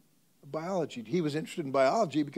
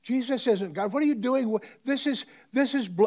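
loud, high, indignant shouting; student shouts angrily at his teacher; outraged protest; 10 semitones higher, 9 dB louder than usual. Retold or imitated. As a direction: cry out in shocked outrage. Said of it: "he gets excited".

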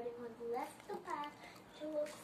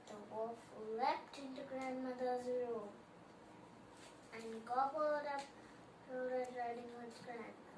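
plain reading voice

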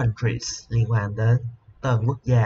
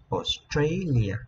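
Australia slowly, with a drawn-out "STRA"; slow os-TRAY-lia, falling at the end; stress on second syllable; 3 semitones higher, much slower than usual. Shown as a demonstration. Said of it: "Chúng ta sẽ nhấn âm thứ hai".

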